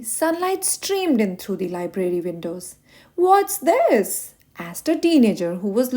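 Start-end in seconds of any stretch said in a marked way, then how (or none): 3.1-4.3 put-on teen's surprise. Teenager finds creepy book.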